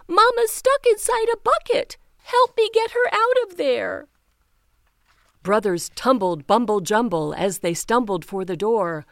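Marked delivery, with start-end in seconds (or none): 0.1-4.1 high, loud, fast panic; frantic panting. Acted. Teeny begging her brothers to save Mama, frantic and high. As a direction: rush it out breathlessly. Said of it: "she panted, frantic".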